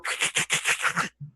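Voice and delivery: voiceless clacking creature laugh; rattling, unvoiced monster cackle; hook horror laugh. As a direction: imitate a monster doubled over laughing. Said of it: "the one that's kinda doubled over".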